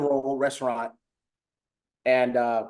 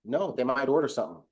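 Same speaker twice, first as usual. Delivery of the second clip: ordinary delivery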